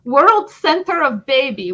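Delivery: funny mock Ukrainian accent; hammed-up Ukrainian accent. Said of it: "in a funny Ukrainian accent".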